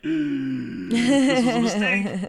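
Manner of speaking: put-on high, slow, exasperated accent muddle; mock exasperated accent mix. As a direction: fumble between British and Australian. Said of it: "whenever I'm switching back and forth between the two of them".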